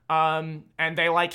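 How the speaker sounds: ordinary talk, nothing marked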